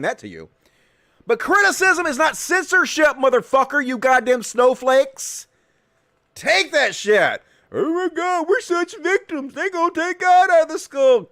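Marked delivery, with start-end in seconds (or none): 1.3-7.4 loud, high, indignant shouting; scornful high-pitched outburst; angry rant. Real, not acted. Rant at clip and its defenders. 7.7-11.3 high, wailing parody of complaint; mocking high-pitched lament; mock victim. Mocks them as victims.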